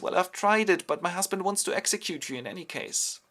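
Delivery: sentimental. Retold or imitated. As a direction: break it to him with sentiment. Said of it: "goes sentimental and says".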